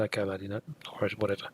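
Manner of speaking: dismissive brush-off. Retold or imitated. To the rude woman at speed dating, a soft dismissive brush-off. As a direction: shrug her off quietly, not worth the fight.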